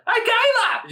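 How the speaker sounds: put-on high, loud yelled Australian accent; heavy Australian accent. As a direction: yell it in a thick Australian accent. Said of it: "yell in this really, like, very heavy, like, Australian accent".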